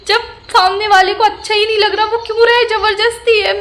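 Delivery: indignant. Real, not acted. Indignant rant about being forced.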